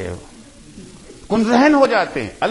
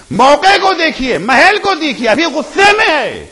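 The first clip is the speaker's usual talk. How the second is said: fervent preaching; 7 semitones higher, 5 dB louder than usual. Real, not acted. Preacher urging parents to wait for the right moment, fervent raised voice. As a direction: drive the point home to the congregation.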